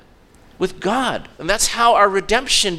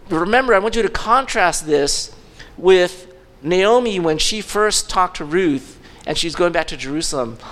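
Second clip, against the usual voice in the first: ordinary talk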